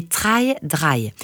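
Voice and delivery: "Try Dry" said very slowly in a clumsy English accent. Shown as a demonstration. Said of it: "un accent anglais, pas très satisfaisant".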